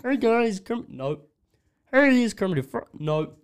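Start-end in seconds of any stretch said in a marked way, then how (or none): 0.0-0.9 high frog voice attempt; Kermit voice. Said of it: "the Kermit voice". 1.9-2.9 high frog voice attempt; Kermit voice. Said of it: "the Kermit voice".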